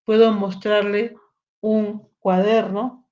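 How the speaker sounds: ordinary talk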